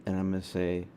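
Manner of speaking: ordinary delivery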